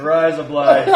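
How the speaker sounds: loud Australian-accent try, cracking up; high, laughing go at Australian; attempted Australian accent. Shown as a demonstration. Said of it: "trying to say it in an Australian accent".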